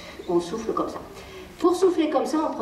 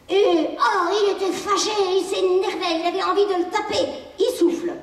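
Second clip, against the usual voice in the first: higher, animated storytelling voice for the angry figure; playing with toys; 8 semitones higher than usual. Parent voicing toys for the child, lightly animated. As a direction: play out the cross little toy for the child.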